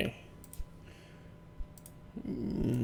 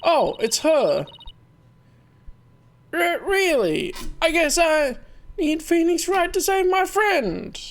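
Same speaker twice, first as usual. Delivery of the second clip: put-on girly character voice; 11 semitones higher than usual. Voicing aquarium girl character.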